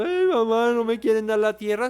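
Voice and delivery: high, whiny crybaby wail; hammed-up tearful complaint; mock crying. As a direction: imitate a kid wailing to mama. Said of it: "Pa' que estés allá llorando".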